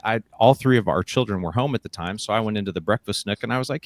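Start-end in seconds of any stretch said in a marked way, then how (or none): none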